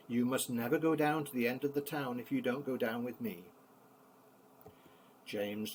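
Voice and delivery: plain reading voice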